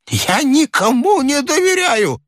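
put-on high, agitated old man's voice; shrill, worked-up protest; heated old man. A narrator's reading. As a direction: cry out in high-pitched outrage. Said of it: "горячился старик".

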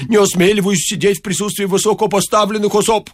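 high obsequious protest; servile priest. A narrator's reading. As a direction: grovel in a high voice. (подобострастно, высоким голосом)

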